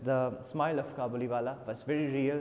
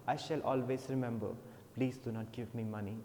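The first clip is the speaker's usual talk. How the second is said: humble soft plea; 5 semitones lower, 6 dB quieter than usual. Acted. Kabuliwala refuses money humbly.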